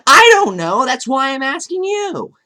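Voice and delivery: loud, high, mock-frustrated demand; mock exasperated shout. YouTuber demands answers from his camera, mock shouting.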